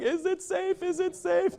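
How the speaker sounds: nervous tourists. Retold or imitated. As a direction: ask it like a scared tourist at a crossing. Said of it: "and they're like".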